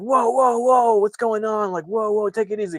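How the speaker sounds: startled guys trying to calm the man holding a gun; alarmed whoa. Retold or imitated. Soldiers shouting at Ahmed to calm down, high alarmed whoas. Everyone freaking out, gun drawn.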